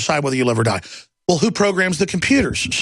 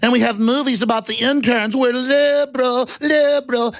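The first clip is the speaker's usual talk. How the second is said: mock liberal; 8 semitones higher than usual. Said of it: "they're always like".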